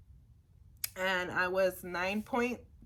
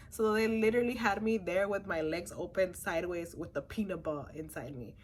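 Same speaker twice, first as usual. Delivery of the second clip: ordinary talk, nothing marked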